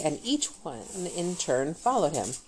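plain reading voice

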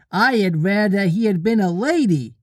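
put-on high, sing-song little boy; childish wishful voice; lost boy interrupting. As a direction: pitch it up as a child butting in.

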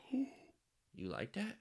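put-on high, soft, drawn-out girl voice; girl at the mall. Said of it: "she's gonna be like".

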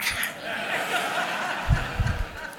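breathy wheezing high laugh; airy unvoiced chuckle; silent laugh. Real, not acted. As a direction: laugh without voice at the irony. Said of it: "And I thought".

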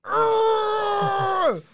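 high, strained Hulk roar. Retold or imitated. Friend retells his outburst at Brandon, Hulk-like roar. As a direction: roar in raging Hulk fashion. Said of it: "all Hulk-like and saying".

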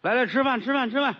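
high-pitched, singsong dinner call; calling to the table. Acted. Husband summoning the family to dumplings, calling out in high pitch.

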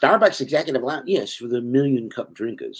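high, put-on British accent; hammed-up British pomposity; British character. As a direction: answer grandly as a British character. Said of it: "this British character".

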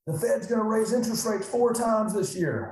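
a hint of client panic; mock panic. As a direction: lightly play the freaked-out investor. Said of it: "People are freaking out".

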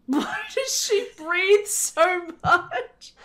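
laughing. Real, not acted. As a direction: barely get it out for laughing. Repeats while laughing.

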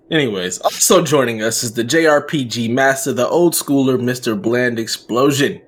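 usual speaking voice

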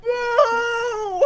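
high, loud "No!" shouted twice; dismayed shout. Real, not acted. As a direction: cry out in mock despair. Dismay at paywall.

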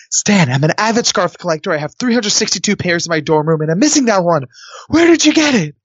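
earnest plea building to a high finish; mock seriousness. Contestant bluffs the producer about his scarf, mock-serious building urgency. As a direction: play it dead serious, climbing to an urgent high question. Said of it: "with all the seriousness I can".

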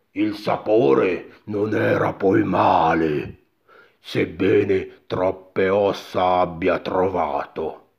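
slow, heavy giant voice; great animal voice. A narrator's reading. Giant after eating the mother, slow beastly voice. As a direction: rumble slowly like a sated ogre. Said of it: "la gran voce animale".

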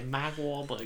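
soft, dropped-R Boston accent; understated Boston accent. Shown as a demonstration. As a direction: say it Boston-style without the R. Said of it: "I would say".

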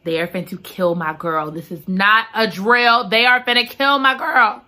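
ordinary talk, nothing marked

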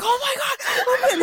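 high-pitched mock panicked scream; retold screaming. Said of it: "I'm fucking screaming".

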